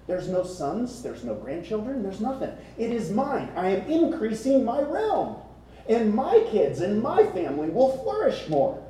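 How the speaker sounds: acted self-interested elation, peaking high; gleeful scheming buyer. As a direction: relish it like someone counting a windfall. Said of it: "This is the thing going through his mind".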